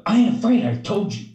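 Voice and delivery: put-on loud, high-pitched defiant kid; mock defiance. Kid insisting to a coach she has no fear, mock defiant.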